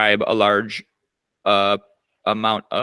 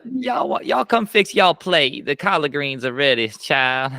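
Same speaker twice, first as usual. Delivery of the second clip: hammed-up Southern drawl, higher and slow; Southern accent; 3 semitones higher, slower than usual. Host mimics a Southern cook calling people to dinner in a hammed-up Southern accent. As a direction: play a Southern auntie calling folks to supper in a Southern accent. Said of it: "that it's Southern accent. I need to hear more".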